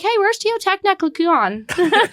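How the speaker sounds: high, slow mock tourist mangling "Teotihuacan"; hammed-up botched pronunciation. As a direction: ask brightly, garbling "Teotihuacan" on purpose. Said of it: "Can you imagine me going to Mexico".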